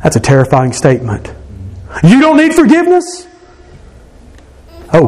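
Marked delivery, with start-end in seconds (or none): 2.0-3.3 high, incredulous question; can't believe what he hears; shocked disbelief, pitched way up. Real, not acted. Incredulous retort to quote.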